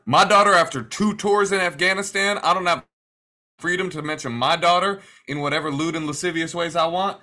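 loud, high outraged veteran; indignant creep voice. Pretend. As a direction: play the offended creep, up loud. Mocking the creepy veteran coworker.

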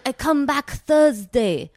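slow, lightly put-on broken English; imitating the dog seller. Mocking the dog seller to friends, slow mild put-on accent. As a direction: drag it out like the seller talking. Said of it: "And she was like".

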